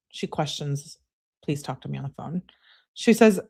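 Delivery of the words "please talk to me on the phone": low, soft, quick read; hushed, rapid quote; offhand citing. Retold or imitated. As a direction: cite the message quickly under the breath. Cites the message being questioned.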